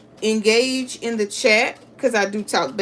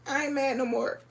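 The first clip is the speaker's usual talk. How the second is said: laughing fake sob, soft; mock crying; 4 semitones higher, 6 dB quieter, faster than usual. Quilter mock-crying to viewers about a missing square. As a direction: pretend to cry through a laugh. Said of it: "I'ma try not to cry".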